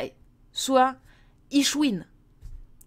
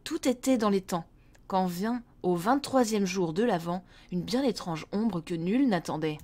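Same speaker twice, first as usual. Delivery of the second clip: storyteller reading a Christmas tale; story narration; 4 semitones lower than usual. A narrator's reading. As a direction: tell it like a bedtime story.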